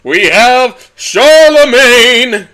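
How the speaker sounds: booming, high announcer call, drawn out; ring announcer. Acted. Ring announcer booming the champion's name to the crowd.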